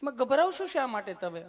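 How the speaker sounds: high, sweet nurse voice; mock sugary nurse voice. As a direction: coo it like the kind nurse. Nurse repeats question.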